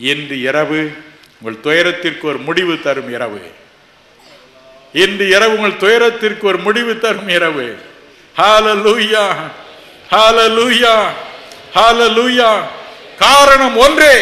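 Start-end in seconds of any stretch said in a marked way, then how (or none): 5.0-7.7 louder, higher declaration; triumphant preacher's declaration; rising proclamation. Acted. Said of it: "repeated louder". 8.4-14.2 loud high jubilant shouts; shouted hallelujah. Acted.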